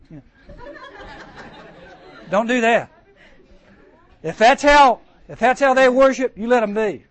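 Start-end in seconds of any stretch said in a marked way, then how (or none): 0.5-2.2 high giggle. Real, not acted. Laugh after mock scolding.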